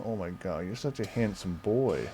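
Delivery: usual speaking voice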